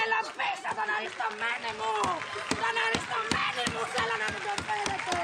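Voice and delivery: wailing demand. Acted. Widow demanding her husband back, wailing.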